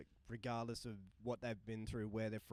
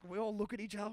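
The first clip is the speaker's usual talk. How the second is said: normal talking voice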